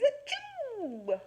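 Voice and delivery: a high, hammed-up achoo; big sneeze. Teacher acting Big Al's sneeze for the kids, big fake sneeze. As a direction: sneeze it out with gusto.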